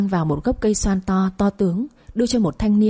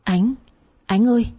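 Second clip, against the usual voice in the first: narrated call through a door, only mildly raised; calling out loudly; 3 semitones higher than usual. A narrator's reading. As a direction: call out with restrained urgency. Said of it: "vừa đập cửa vừa cố la lớn". (gọi với, hơi cao giọng)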